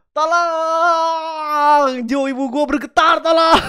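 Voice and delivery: long, high drawn-out plea, then breaking into laughter; hammed-up wail. Real, not acted. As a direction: wail for help, playfully overwhelmed by cuteness. Overcome by cuteness, begs for help. (rengekan gemas yang dilebih-lebihkan)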